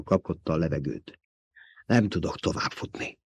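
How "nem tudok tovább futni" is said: softer, breathless and spent; panting. A narrator's reading. Jessica out of breath on the rocks, panting. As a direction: gasp it out, exhausted. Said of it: "lihegte Jessica".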